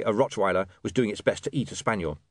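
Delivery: ordinary delivery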